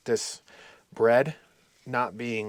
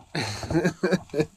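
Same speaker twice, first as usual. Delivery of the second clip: light rolling chuckle; real giggle; self-amused chuckle; 3 semitones higher than usual. Real, not acted. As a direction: laugh at your own silliness. Amused by own work it.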